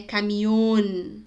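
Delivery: "Caminhão" slowly, as camión with a closed o. Shown as a demonstration. Teacher giving an example, slow closed vowel. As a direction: stretch the closed ôn ending of "Caminhão". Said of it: "O O é sempre bem fechado".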